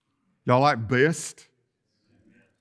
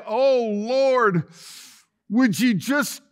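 much higher, louder, hammed-up plea to the Lord; mock pleading prayer; 10 semitones higher, 6 dB louder than usual. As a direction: play a fed-up pray-er begging God to fix relatives. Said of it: "and you say".

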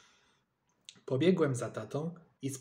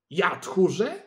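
louder, higher offended retort; indignant shout; 4 semitones higher, 7 dB louder, slower than usual. A narrator's reading. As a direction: throw the insult back. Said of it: "krzyknął".

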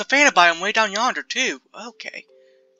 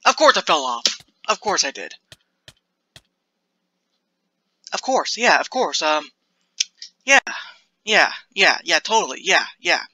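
sarcastic resignation. Real, not acted. Fell off, sarcastic.

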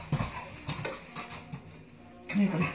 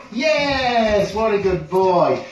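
high, slow drawn-out praise; gushing praise; 7 semitones higher, 4 dB louder, much slower than usual. Real, not acted. Trainer praising the dog from further away, high slow gushing praise. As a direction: stretch the praise out high and happy.

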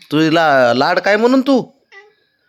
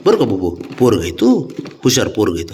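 soothing; 7 semitones lower than usual. Real, not acted. Soothing the sulking cat.

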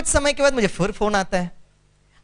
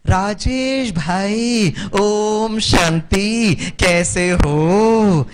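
slow, louder, drawn-out heartfelt greeting; warm recognition; 4 dB louder, much slower than usual. Retold or imitated. Suddenly recognizing Rajesh on the phone, slow, warm, delighted greeting. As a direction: stretch each word with glad recognition.

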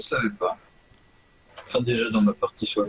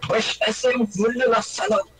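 put-on German accent; 8 semitones higher than usual. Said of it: "J'ai pris un accent allemand".

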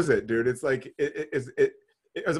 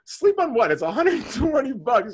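exasperated inner voice; 6 semitones higher, 4 dB louder than usual. Retold or imitated. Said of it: "I'm thinking".

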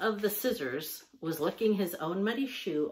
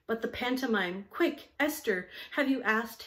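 impatient cry; 6 semitones higher, 3 dB louder than usual. A narrator's reading. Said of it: "they cried impatiently".

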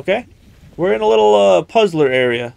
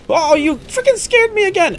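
startled yelp; 14 semitones higher than usual. Real, not acted. Jump scare in game.

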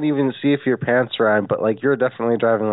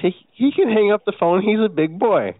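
much higher, pushy and mock-earnest; high insistent pleading; 6 semitones higher than usual. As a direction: plead it up high like a wheedling child. Repeats to the manager, pushing harder.

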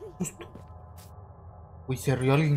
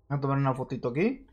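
ordinary delivery

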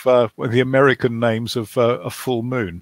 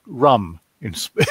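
ordinary talk

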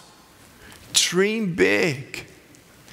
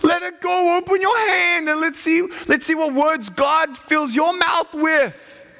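high, fervent preacher's call; fervent preaching; 10 semitones higher than usual. Acted. Preacher urging students to drop swearing, fervent. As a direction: plead with the crowd to let it go.

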